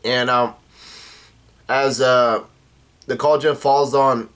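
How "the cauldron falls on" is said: plain reading voice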